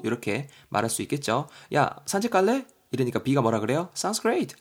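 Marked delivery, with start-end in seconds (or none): 1.8-2.7 higher friendly ask. Acted. A's line in Korean. 3.9-4.6 high bright reply. Acted. B's reply.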